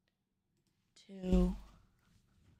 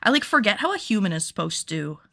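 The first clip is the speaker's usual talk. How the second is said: usual speaking voice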